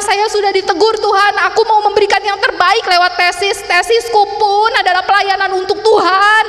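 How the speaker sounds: high, loud retold wail; hammed-up crying complaint; tearful, unwilling protest. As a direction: plead loudly to God, reenacting the cry. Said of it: "kenapa saya nangis? Saya nggak rela".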